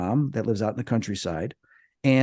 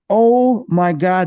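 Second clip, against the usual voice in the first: slow, drawn-out, louder "oh my God"; retold amazement; 6 semitones higher, 7 dB louder, much slower than usual. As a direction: replay a dawning astonishment. Retelling amazed realization.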